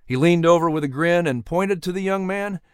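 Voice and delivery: plain reading voice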